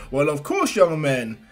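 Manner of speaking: hearty hero speech with loud bursts; All Might. Acted. Hero encouraging a young fan, big and hearty. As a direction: play the showy hero, punching phrases out.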